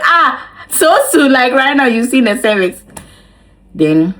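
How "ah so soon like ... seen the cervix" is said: high, loud happy disbelief; thrilled exclamation; overjoyed. Retold or imitated. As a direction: gush with relieved joy. Overjoyed reaction quote.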